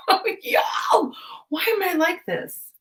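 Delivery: high, cracking laugh-cry; laughing whine. Real, not acted. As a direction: whine it through real laughter. Laughing at own clumsiness.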